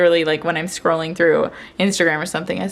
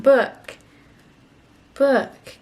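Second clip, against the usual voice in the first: "Book." high with a falling end; coaching a toddler to say "Book."; modelling a word; 5 semitones higher than usual. Shown as a demonstration. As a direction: show her how to say "Book.". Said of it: "Can you say book?".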